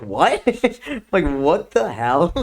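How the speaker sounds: loud, laughing re-enacted disbelief; incredulous. Retells shock at girlfriend coincidence.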